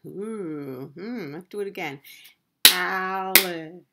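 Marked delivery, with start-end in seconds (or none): 2.7-3.8 "Alligator" in four separate, level beats; syllable by syllable. Shown as a demonstration. Teacher doing it again for children, syllable by syllable. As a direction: clap out "Alligator" one even syllable at a time.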